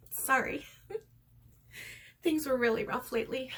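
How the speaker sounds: a little choked up; teary-eyed. Real, not acted. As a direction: apologize while holding back real tears. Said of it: "I'm getting a little teary-eyed here".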